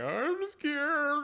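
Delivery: hammed-up fearful wail; very high, loud mock squeal; Sato. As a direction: wail it in exaggerated panic. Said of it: "Sato the entire time is just basically like".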